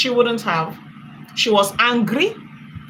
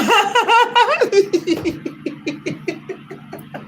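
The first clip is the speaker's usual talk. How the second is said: high laugh; 10 semitones higher than usual. Real, not acted. Laughing at her voice.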